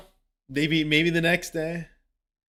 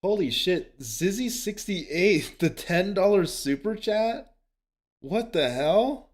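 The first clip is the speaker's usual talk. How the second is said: stunned surprise; 7 semitones higher, slower than usual. Real, not acted. Streamer stunned by big donation.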